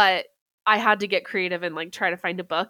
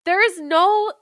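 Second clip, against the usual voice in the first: higher, louder exasperated complaint; 8 semitones higher, 6 dB louder than usual. Retold or imitated. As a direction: complain loudly and high. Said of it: "I'm like".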